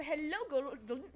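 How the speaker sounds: ordinary delivery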